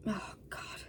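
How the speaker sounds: breathy whispered aside; whispered oh God. Real, not acted. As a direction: whisper it, worn out. Emotional aside after throat clearing.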